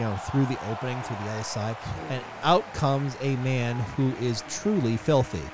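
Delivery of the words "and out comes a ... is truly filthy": usual speaking voice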